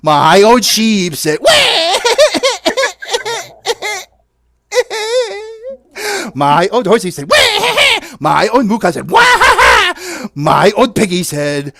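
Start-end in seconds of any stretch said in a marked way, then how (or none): 1.4-6.3 very high mock baby cry with laughter; mock baby wail. Host plays the crying baby, mock wail. 7.3-8.0 very high mock baby cry with laughter; mock baby wail. Host plays the crying baby, mock wail. 9.1-9.9 very high mock baby cry with laughter; mock baby wail. Host plays the crying baby, mock wail.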